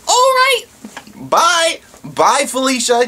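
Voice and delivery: very high, sing-song dismissive bye; mock sweet farewell; sarcastic bye. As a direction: wave her off mockingly. Sarcastic goodbye after hang-up.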